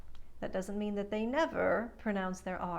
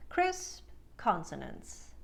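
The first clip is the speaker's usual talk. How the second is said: normal talking voice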